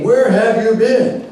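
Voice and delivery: high, drawn-out accusing question; mock reproach. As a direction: play a complainer demanding an explanation. Imagined reproach Paul did not make.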